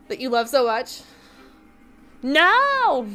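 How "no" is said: high, drawn-out shout of no; loud protest. Real, not acted. Streamer protesting to the chat, loud high shout. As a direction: cry out in big, playful refusal. Playful outburst at being teased.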